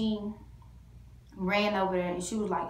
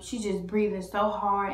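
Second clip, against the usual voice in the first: ordinary talk, nothing marked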